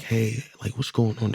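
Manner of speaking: softly spoken dad voice; quiet talk. Retold or imitated. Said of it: "talking to 'em".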